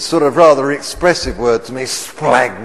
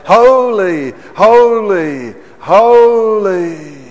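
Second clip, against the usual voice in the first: loud, high cry of "Holy, holy, holy"; 7 semitones higher, 8 dB louder, slower than usual. Retold or imitated. Preacher voicing the living creatures, loud high cry. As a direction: call it out like the creatures around the throne. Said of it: "And they cried".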